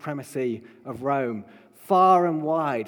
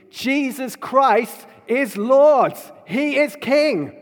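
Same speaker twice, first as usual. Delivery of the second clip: loud, high proclamation, slowing on the climax; booming proclamation; 5 semitones higher, 7 dB louder, slower than usual. Acted. Preacher warning empires and the wealthy that Jesus is King, loud proclamation. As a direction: declare it like a herald to empires.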